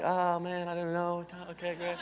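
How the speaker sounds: soft, reluctant acted reply; hesitant murmur. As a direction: play someone quietly unsure while being flirted with.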